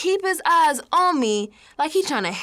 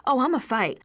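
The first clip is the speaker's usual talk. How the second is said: usual speaking voice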